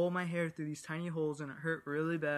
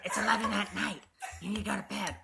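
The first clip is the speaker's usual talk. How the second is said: higher, louder scolding grandma; 6 semitones higher, 5 dB louder than usual. Retold or imitated. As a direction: nag like a cranky grandma. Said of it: "she's like".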